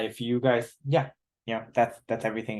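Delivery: usual speaking voice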